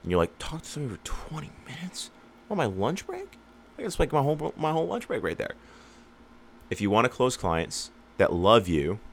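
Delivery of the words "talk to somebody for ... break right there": high, incredulous mock outrage; mock disbelief. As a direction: squeak it out in fake shock.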